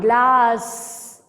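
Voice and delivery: "Glass" with the final S held long and hissed; long S sound. Shown as a demonstration. Teacher showing a child the double S: long hissed S in "Glass". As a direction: stretch the S at the end of "Glass". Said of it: "Double S, long sound".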